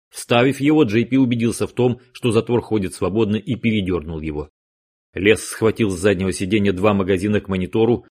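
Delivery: ordinary delivery, nothing marked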